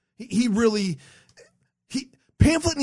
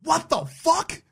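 incredulous what the fuck; 9 semitones higher, slower than usual. Retold or imitated. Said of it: "you're like".

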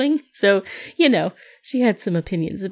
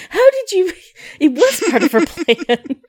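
high, loud, amazed cry; mock astonishment; 10 semitones higher, 6 dB louder than usual. Friend plays mom finding the diary, astonished. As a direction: gasp it out like the trap just sprang. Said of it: "and then it's like".

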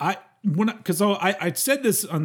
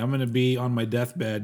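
ordinary talk

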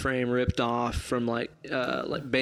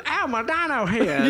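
goofy mock whine; 10 semitones higher, 3 dB louder than usual. Mocking a character in movie.

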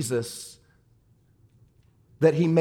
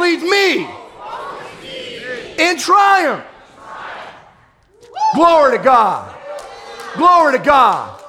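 preaching at full force; 11 semitones higher, slower than usual. Acted.

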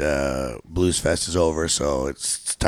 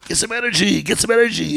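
mock yell; 13 semitones higher than usual. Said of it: "yelling at the audience all the time".

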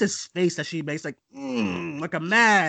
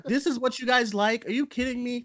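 raised pitch, mock-outraged disbelief; incredulous disgust; 7 semitones higher than usual. To the cheering audience, in disbelieving scorn. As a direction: scoff at the crowd's taste. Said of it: "I'm just like".

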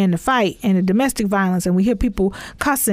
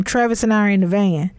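normal talking voice

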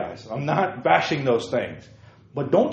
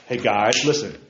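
ordinary delivery

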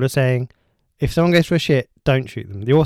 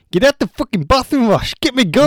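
a loud, raised, deliberately bad accent; a bad Geordie-style accent, pitched up and loud; awful accent; 9 semitones higher, 7 dB louder than usual. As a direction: ham up a terrible accent and shout it. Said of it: "Awful accent".

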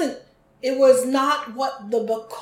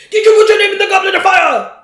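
fast, much higher, loud furious shout; Gambon's storming Dumbledore; 8 semitones higher, 11 dB louder, faster than usual. Retold or imitated. Co-host mimics movie Dumbledore storming at Harry, fast furious shout. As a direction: hurl it at him like an accusation. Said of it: "storms in and storms at him".

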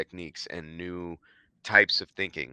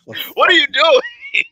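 squealing laugh; 12 dB louder, slower than usual. Acted. Mock outrage at funny reply.

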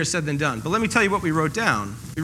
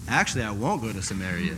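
sudden refusal; 10 semitones lower, 7 dB quieter than usual. Retold or imitated. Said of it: "And people are like".

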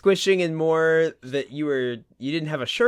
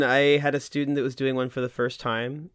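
usual speaking voice

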